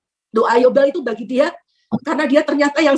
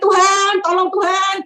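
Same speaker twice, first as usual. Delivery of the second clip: mildly raised plea to God; mock cry for help, pitched up; retold inner cry; 4 semitones higher, slower than usual. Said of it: "saya dalam hati itu menjerit". (jeritan hati, sedikit dibesar-besarkan)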